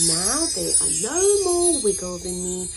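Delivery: ordinary delivery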